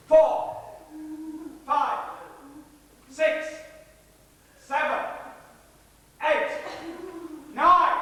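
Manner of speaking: high, loud count with long gaps; threatening countdown. Acted. Gunman counting down to firing, loud count. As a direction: call out each number, peaking in a shout.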